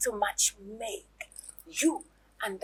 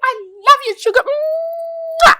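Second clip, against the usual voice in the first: gushing; 11 dB louder, slower than usual. Acted. Gushing affection to husband.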